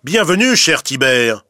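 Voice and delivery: aloud, falsely welcoming. A narrator's reading. Fox loudly greeting the cat he plans to trick, falsely friendly. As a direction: call out a fake warm welcome. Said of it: "Puis à voix haute".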